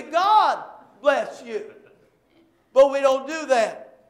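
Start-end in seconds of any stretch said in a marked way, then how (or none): 0.0-1.7 "God bless you" said slow, stress on God; slow deliberate "God bless you"; full blessing, slowly. Shown as a demonstration. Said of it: "It should be".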